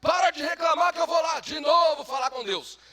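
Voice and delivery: high, loud angry shout; furious leader shouting them down; angry Moses. Acted. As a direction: yell at the crowd to stop. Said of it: "Moisés ficou bravo e falou".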